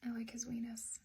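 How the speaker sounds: whispered. Retold or imitated. Kid whispering to his classmate, smiling, a sly whisper. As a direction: breathe it as a sly secret. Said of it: "kinda smiles and goes".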